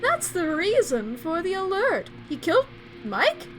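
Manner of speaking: urgent warning. Acted.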